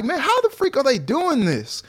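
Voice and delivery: mock amazement at the Bucks; disbelief. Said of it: "I was just like".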